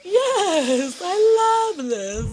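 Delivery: high loud fake enthusiasm; forced hype. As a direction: hype yourself up, hammed. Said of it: "It's like".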